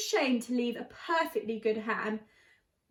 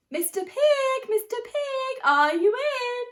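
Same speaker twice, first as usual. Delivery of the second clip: calling out; 11 semitones higher, 6 dB louder, slower than usual. Acted. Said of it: "I called".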